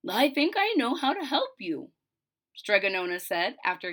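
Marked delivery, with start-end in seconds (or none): 0.1-1.9 a hint of a gentle old witch; kindly witch. Witch offering help to the girl, lightly voiced.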